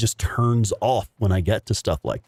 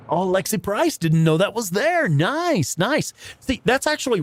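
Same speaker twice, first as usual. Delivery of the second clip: excited; 10 semitones higher than usual. Real, not acted. Excited streamer reads chat.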